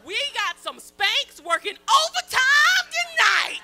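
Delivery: comic holler. Acted. Comic to crowd about her Spanx, hollering. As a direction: holler it slowly to the whole room.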